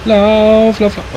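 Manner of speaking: panicked high yelling to run; frantic shrill urging to flee; being chased. Real, not acted.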